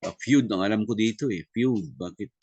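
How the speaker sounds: normal talking voice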